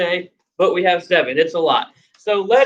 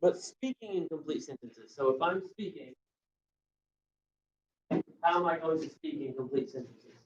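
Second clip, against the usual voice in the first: quiet aside; 17 dB quieter than usual. Real, not acted. Teacher drops voice to class.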